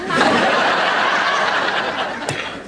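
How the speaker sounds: airy scornful chuckle; breathy mocking laugh. Mocking after toga jibe.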